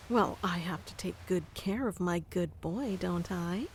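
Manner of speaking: ordinary delivery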